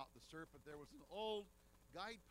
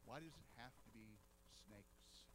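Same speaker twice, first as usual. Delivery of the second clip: lower, softer, fading to a whisper on snakes; Eve's inner thought; 6 semitones lower, 8 dB quieter than usual. Retold or imitated. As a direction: mutter it to yourself in dread. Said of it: "I'll bet Eve was thinking the very same thing".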